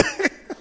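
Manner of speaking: trailing giggle; high laugh. Real, not acted. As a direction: let a little laugh out. Laugh after the story.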